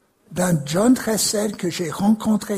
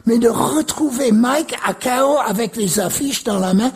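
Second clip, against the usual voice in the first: ordinary delivery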